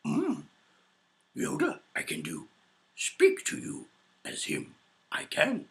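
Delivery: slow, halting alien sage with odd hums; Yoda. Acted. Answering a fan's impression request, as Yoda. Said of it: "Do a Yoda impression".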